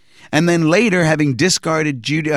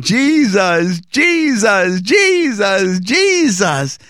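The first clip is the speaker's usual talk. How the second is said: higher and louder, ecstatic cries of Jesus; ecstatic calling; 6 semitones higher, 3 dB louder, much slower than usual. Retold or imitated. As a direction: cry the name out like a man in ecstasy. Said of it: "but now I'm calling".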